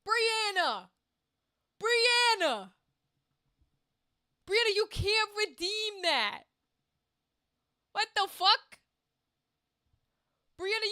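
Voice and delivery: exasperated shouting; loud protest. Real, not acted. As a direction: yell the name, then object, stretching the words. Calling out viewer over a redeem.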